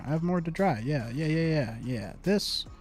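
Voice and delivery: normal talking voice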